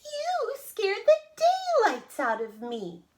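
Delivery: very high, slow, loud cry; hammed-up old-lady fright, drawn out; startled tiny old woman. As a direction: cry out slowly in high-pitched shock. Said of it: "cried the tiny old woman".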